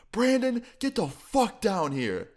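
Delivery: very high, drawn-out mock yell; angry summons. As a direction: imitate a furious dad calling upstairs. Angry dad calls son downstairs.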